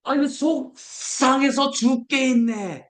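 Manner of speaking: high, slow, drawn-out sigh of a parent; exasperated parent. Retold or imitated. Preacher imitating a parent looking at her son, high and slow. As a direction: stretch it out like a worn-out mother. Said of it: "아들을 보면".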